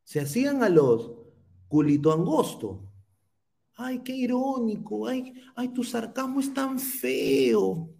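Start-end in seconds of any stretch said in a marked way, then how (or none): 3.8-7.9 high, whiny mock complaint; mocking ex-panelists. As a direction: imitate fussy critics in a high, softened whine. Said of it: "se quejaban de la ironía".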